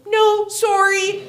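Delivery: high, drawn-out polite refusal; loud, squeaky-polite resistance; apologetic people pleaser. Retold or imitated. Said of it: "I'm, like, such a people pleaser".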